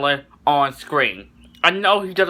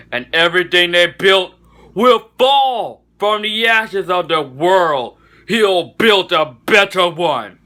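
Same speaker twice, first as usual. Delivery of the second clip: slow, drawn-out villain proclamation, pitched up; raised-pitch, slow, grandiose doom speech; terrible Apocalypse impression; 2 semitones higher, 3 dB louder, slower than usual. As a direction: declaim it like a hammed-up supervillain, words stretched. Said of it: "Sorry for that terrible Apocalypse imp".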